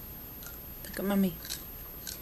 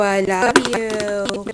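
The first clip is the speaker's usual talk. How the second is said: drawn out slowly; 3 dB louder, much slower than usual. Real, not acted. Mom models words for grandma.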